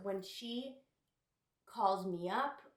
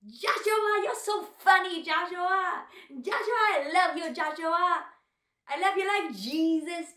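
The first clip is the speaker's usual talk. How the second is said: high, loud gushing character voice; Louise; 9 semitones higher, 9 dB louder than usual. Acted. Louise gushing to Joshua, loud hammed-up impression. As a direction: cry out the boy's name over and over, high and loud. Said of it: "And then Louise would be like".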